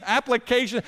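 ordinary delivery

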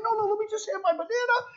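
high, loud and quick, playing dumb; high excited pleading. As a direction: beg for the banana in a high voice. Pastor acting confused with kids.

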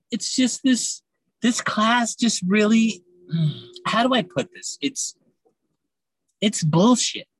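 ordinary talk, nothing marked

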